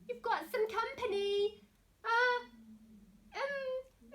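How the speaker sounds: high, hesitant little mouse voice; timid mouse. Acted. Mouse nervously waking the lion, high and timid. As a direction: squeak it out nervously.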